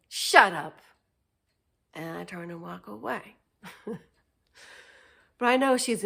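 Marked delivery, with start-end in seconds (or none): 0.1-0.8 loud, flat "shut up"; blunt retort. Retold or imitated. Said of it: "And then I say".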